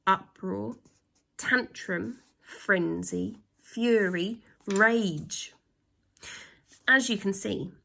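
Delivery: ordinary delivery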